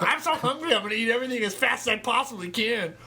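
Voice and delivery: squeaky, high cat voice; voicing the cat. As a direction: play the greedy starving cat. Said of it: "He's like".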